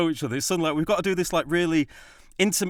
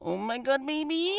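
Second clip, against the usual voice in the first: high, strangled squeak of a line, softer; much higher, softer, awkward mock-passionate squeal; what actually came out; 10 semitones higher, 4 dB quieter than usual. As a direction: blurt it way up high like a panicked teen. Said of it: "What came out was like".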